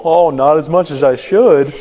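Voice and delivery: drawn-out, put-on excuse to the hygienist; typical sheepish excuse. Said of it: "I give her the typical".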